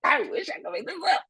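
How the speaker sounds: high, laughing protest; insisting. Real, not acted. Assuring Alicia the story is true, high squeal. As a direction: squeal that it really happened.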